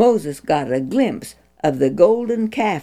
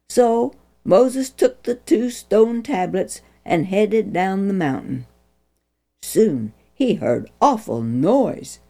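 plain reading voice

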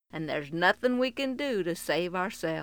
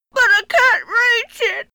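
put-on child voice; 13 semitones higher, 12 dB louder than usual. Trapped boy pleads.

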